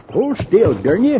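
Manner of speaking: annoyed. Acted. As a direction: snap at the fidgeting horse. Darn you.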